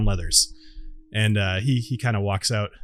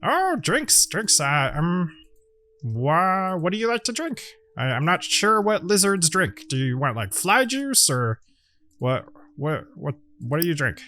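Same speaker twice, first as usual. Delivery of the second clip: boastful gnome; 4 semitones higher than usual. Acted. Indiana Gnomes introduces his friends, higher and proud. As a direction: show off your crew like a showman.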